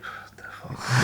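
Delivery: breathless slow whisper; whispered disbelief. Retold or imitated. Hearing the locals complain about them, a slow stunned whisper. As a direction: mutter it under your breath, stunned.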